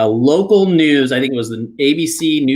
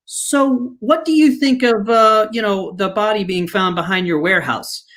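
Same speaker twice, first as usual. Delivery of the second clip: lightly voiced higher reporter question; mock reporter question; 5 semitones higher than usual. Reporter asking Mike about the body, mock reporter tone. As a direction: ask it like a TV reporter off camera.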